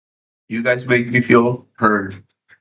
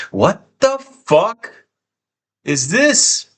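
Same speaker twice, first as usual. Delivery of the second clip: shocked disbelief; 8 semitones higher, much slower than usual. Real, not acted. Sudden hype train on stream.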